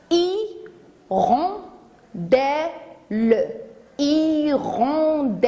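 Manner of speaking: "Hirondelle" drawn out as i, ron, de, le; slow, stretched beats on "Hirondelle"; syllable by syllable. Shown as a demonstration. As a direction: break "Hirondelle" up, then link it in slow motion. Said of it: "Écoutez".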